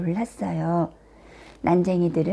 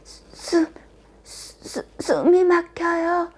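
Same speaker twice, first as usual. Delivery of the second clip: high, halting gasps for air; acted suffocating princess; choking distress; 9 semitones higher, slower than usual. A narrator's reading. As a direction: stammer it out as if being strangled. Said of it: "백설공주는 괴로워하며".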